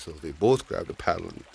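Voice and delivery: usual speaking voice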